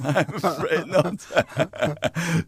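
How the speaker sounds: slow, laughing through the words; sheepish drawn-out laugh; laughing admission. Real, not acted. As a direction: chuckle out the confession. Admits not cheering for Ireland.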